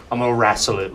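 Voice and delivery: put-on a light, keen Australian accent; a croc-wrestler's brag in a lightly done Aussie accent. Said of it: "an Australian accent".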